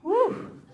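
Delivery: high loud whoosh of relief; mock relief, loud and high; relieved whew. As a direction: blow out a big relieved whew. Relief after fooling clerk.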